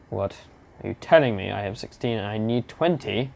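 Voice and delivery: ordinary talk, nothing marked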